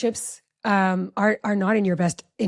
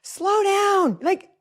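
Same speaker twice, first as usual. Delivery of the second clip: anxious high-pitched scolding; 11 semitones higher, slower than usual. Retold or imitated. Mom retelling warning her speeding daughter, anxious high-pitched voice. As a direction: re-enact a heated passenger's plea. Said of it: "high, like, pitch voice".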